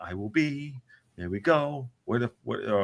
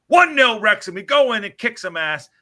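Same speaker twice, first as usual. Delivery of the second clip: louder, higher fired-up team talk; rallying call; 5 semitones higher, 8 dB louder than usual. Acted. Host rallying the club at halftime, fired-up shout. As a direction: pump up the team.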